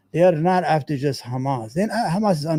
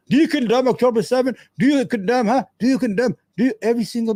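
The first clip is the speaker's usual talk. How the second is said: higher, fast, badgering mock interviewer; lightly voiced pushy host, higher and quicker; 6 semitones higher, faster than usual. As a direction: pester him like a pushy TV host.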